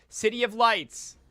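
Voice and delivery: over-enunciate as if to the hard of hearing. Acted. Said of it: "She raises her voice and starts speaking slowly".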